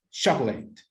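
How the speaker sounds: "chocolate" said clearly for counting syllables; teacher's dictation of "chocolate"; slow model word. Shown as a demonstration. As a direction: model "chocolate" slowly as CHOC-o-late. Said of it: "How many syllables can you hear?".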